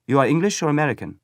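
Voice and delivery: quick eager question; German boy. A narrator's reading. Boy asks Paul his nationality, quick high boyish voice.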